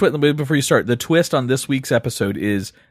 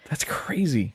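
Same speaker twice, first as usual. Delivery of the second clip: whisper; 7 dB quieter, much slower than usual. Real, not acted. Whispered aside.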